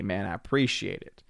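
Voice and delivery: normal talking voice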